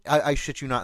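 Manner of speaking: ordinary delivery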